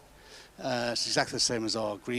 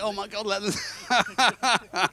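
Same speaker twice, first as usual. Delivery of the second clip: surprised exclamation; 9 semitones higher, 4 dB louder, much slower than usual. Retold or imitated. Retold panic in car.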